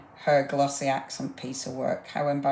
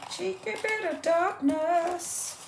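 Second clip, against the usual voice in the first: high, slow teasing sing-song; playful mock-naughty tone; cheeky; 14 semitones higher, slower than usual. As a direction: tease it out with a wink in the voice. Playfully picks a dark sticker.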